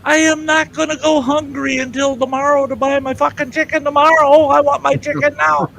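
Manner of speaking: high indignant demand. Retold or imitated. Customer demanding chicken from clerk, high indignant rant. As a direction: insist on it, pitched high.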